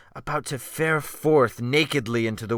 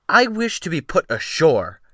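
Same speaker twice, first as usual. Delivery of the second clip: higher, firm and composed; firm request; 5 semitones higher, 4 dB louder than usual. A narrator's reading. Gentleman demanding to be put ashore, firmly. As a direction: say it with regained control. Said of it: "I said firmly".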